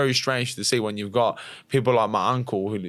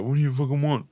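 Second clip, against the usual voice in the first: higher, brusque imitation of dad; mock an irritated father answering the phone; curt dad impression, lightly hammed-up; gruff dad retold; 4 semitones higher than usual. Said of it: "That's how he talks to me".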